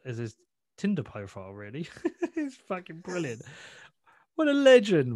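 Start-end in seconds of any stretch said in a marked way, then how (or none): 1.9-3.4 high, amused and laughing; delighted praise through a laugh; laughing admiration. Real, not acted. Laughs at friend's stunt. 4.4-5.1 loud, high cry of admiration; real burst of delighted praise; excited admiration. Real, not acted. Hails admired friend.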